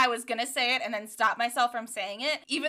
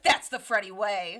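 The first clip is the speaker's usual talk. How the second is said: low, put-on boy hero voice; Freddie impression; 4 semitones lower than usual. Host voicing Freddie's response, low and put-on. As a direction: state it low, like a motto. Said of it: "Freddie responds to this with".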